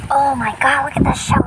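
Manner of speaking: usual speaking voice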